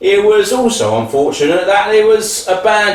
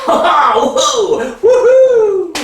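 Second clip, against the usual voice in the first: excited whoop; 5 semitones higher, much slower than usual. Acted. Son heading off to party in Ibiza, whooping.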